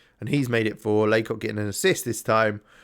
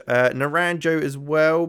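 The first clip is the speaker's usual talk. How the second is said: ordinary talk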